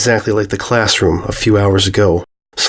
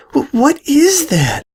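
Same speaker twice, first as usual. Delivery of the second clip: much higher, slow, stammering in fear; a scared filly barely gets the words out; frightened filly stammers at the strange fruit; slower than usual. Acted. Filly sees the grotesque fruit.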